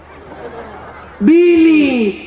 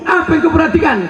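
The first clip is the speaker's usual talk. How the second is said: mocking shout; 9 semitones higher, faster than usual. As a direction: fire the question at them, scoffing. Mocking repeated question.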